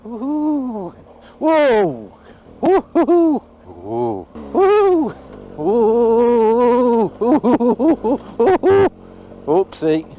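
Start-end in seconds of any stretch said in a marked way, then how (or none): 0.1-7.1 loud high oohs and whoas; high, loud whoops. Real, not acted. Rider on a rough downhill, loud high whoops. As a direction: whoop at every bump. Bumpy descent on bike.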